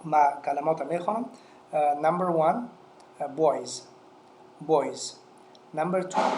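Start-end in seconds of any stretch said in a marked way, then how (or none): 3.4-5.1 "boys" with the final s voiced as z. Shown as a demonstration. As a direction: model "boys" clearly, ending in a z sound.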